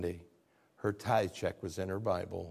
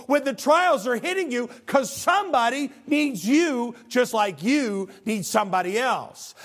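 preaching at full force; 13 semitones higher, 9 dB louder than usual. Acted. Pastor exhorting his congregation to stay faithful, full-force preaching. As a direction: proclaim it to the whole church.

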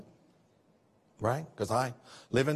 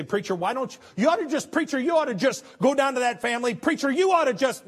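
pushy, much higher mock nagging; nagging churchgoer; 8 semitones higher, 3 dB louder than usual. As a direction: needle the pastor with advice. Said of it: "a lot of times people say".

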